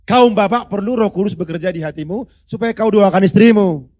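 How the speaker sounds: fiery pulpit shout to the fathers; preaching shout. Real, not acted. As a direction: thunder it at the husbands. Preacher driving home the point.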